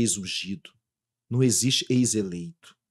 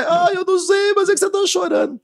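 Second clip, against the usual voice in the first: much higher, light child voice imitating his son; high, slightly louder imitation of a young child; small child's voice; 8 dB louder than usual. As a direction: play the little boy, puzzled. Young son answers father.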